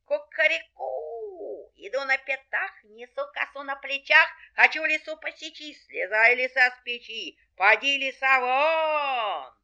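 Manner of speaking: high crow into a forceful chant; crowing rooster. Acted. Rooster threatens the fox a last time, commanding. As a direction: crow long, then chant the threat strongly.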